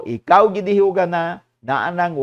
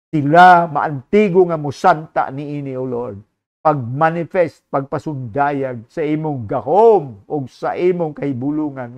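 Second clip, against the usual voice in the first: fervent prayer; 3 dB louder than usual. Acted. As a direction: pray over the congregation, pleading and earnest. Pastor leads closing prayer.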